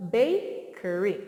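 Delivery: bakery said slowly, ba stretched long; sounded-out word. Shown as a demonstration. Teacher sounding out bakery for young learners. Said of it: "And this says".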